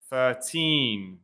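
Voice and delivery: put-on "thirteen" with the weight on "teen"; "thirteen", second syllable stressed. Shown as a demonstration. Teacher modelling the stress in "thirteen". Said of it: "you put the stress in the second syllable".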